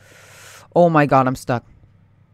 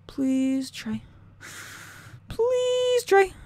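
high, squeaky begging; desperate pleading; much slower than usual. Real, not acted. Gamer begging the game, high and desperate.

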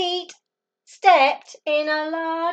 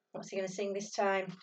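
ordinary delivery

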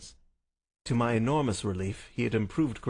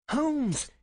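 high call to rouse someone; insistent cry; 3 dB louder than usual. Acted. Visitor tries to rouse the sick man, insistent cry. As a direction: cry out to wake a sleeper. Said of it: "insistent tone of one who awakens a sleeper".

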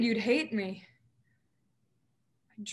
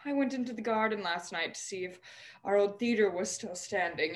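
normal talking voice

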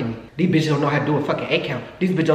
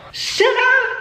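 very high, loud squeal; squeaky excited should I; squealed question; 4 dB louder than usual. Real, not acted. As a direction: tease them with it. Excited tease.

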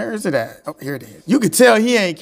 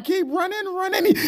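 mock cheer; 12 semitones higher, 6 dB quieter than usual. A mock cheer as he runs away.

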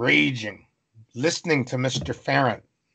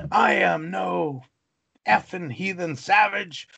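higher, slow, defiant outburst; defiant retort; 5 semitones higher, slower than usual. Retold or imitated. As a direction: spit it back at the headmaster. Boy defies the headmaster.

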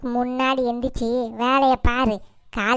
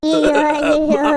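wailing cry; 5 dB louder, much slower than usual. Acted. Mother wailing over poisoned son.

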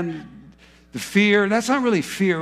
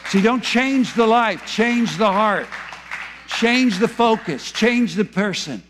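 slow, raised, each phrase hammered home; emphatic slow preaching; 5 semitones higher, slower than usual. Real, not acted. Preacher urging the congregation, slow and emphatic. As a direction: preach it out slowly, pressing every change.

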